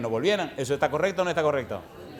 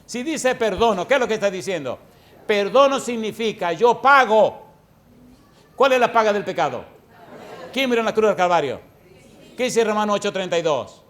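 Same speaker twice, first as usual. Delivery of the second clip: higher, louder pulpit delivery; forceful preaching; 5 semitones higher, 6 dB louder than usual. Real, not acted. Preacher explaining what forgiveness means, loud and emphatic. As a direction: hammer out each question to the congregation.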